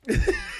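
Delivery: a short, very high burst of laughter; quick, very high real laugh. Real, not acted. Laughing at clip.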